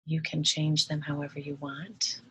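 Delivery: soft, unhurried meditation voice with long pauses; guided meditation. Acted. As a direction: ease the listener into relaxing, hushed and gentle.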